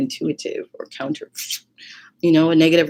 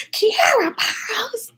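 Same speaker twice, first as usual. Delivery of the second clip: retold scream; much slower than usual. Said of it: "My grandma screams".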